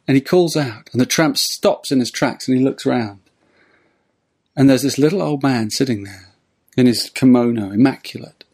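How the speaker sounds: plain reading voice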